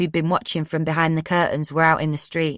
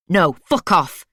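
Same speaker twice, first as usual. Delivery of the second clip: snapped back; 4 dB louder, slower than usual. A narrator's reading. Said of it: "she snapped back".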